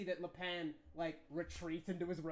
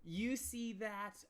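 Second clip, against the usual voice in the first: usual speaking voice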